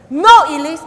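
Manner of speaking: sharp loud scolding cry; angry no. Retold or imitated. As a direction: scold the toddler loudly. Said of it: "Joy was very angry".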